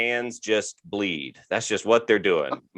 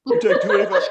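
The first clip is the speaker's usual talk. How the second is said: high-pitched mock dad rattling off highway numbers; excitable, much higher dad voice; imitating dad; 11 semitones higher, faster than usual. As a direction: caricature a dad obsessed with routes. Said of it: "he's like".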